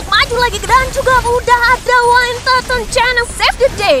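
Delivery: hyped shout. Real, not acted. Caster peaks on big save.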